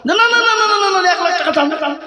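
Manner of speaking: high rapid string of no no no; mock eager donor insisting; insistent no. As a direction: pile on the refusals, high and eager. Retold donor insisting loudly.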